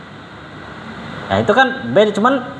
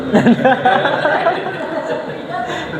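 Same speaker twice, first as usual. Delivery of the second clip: laughing at the student's joke; high laugh; 10 semitones higher than usual. Real, not acted. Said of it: "iya bener".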